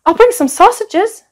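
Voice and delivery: high eager dragon asking. A narrator's reading. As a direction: beg eagerly like a keen child. Dragon offers food.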